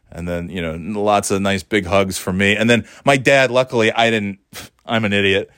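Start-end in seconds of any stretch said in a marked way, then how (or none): none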